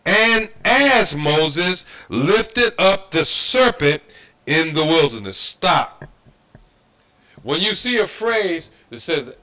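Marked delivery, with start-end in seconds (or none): 0.1-5.4 loud, very slow reading of the verse; slow scripture read. Shown as a demonstration. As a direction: lay each word down slowly. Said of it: "We're gonna say it again".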